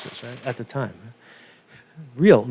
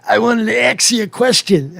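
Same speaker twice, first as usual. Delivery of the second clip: put-on higher, loud, slow tough-guy New York accent; Staten Island mobster; 7 semitones higher, 3 dB louder than usual. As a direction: greet like a Staten Island wiseguy, big and friendly. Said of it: "these are guys like".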